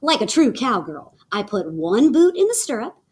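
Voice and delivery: plain reading voice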